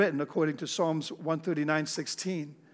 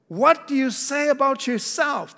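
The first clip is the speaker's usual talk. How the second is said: much higher, louder pressing question; the priests demanding an answer, much higher; 8 semitones higher, 5 dB louder than usual. Acted. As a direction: push the question at him, high and loud. Priests question John the Baptist.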